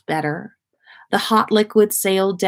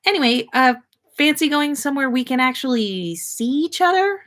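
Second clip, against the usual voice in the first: put-on higher, eager young girl; lightly voiced girl; 7 semitones higher than usual. A narrator's reading. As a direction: play Hannah bright and chatty. Hannah's voice in story.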